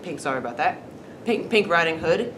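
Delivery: plain reading voice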